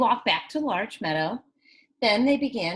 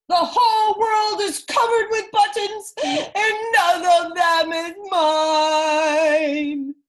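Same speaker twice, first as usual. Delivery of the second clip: high, loud wailing tantrum, slowing to a sung moan; screaming tantrum; 8 semitones higher, 7 dB louder, slower than usual.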